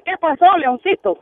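high-pitched nervous little question; scared donkey. Acted. Donkey answering the lion who might eat him, scared. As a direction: play a frightened donkey asking what's up. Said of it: "el burro asustado le dice".

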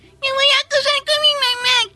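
put-on high whiny parody of a tattling child; mocking mimicry. Teacher mimics pupil's tattling threat.

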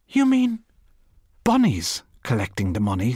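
surprised. Acted. Missus Cork's startled question.

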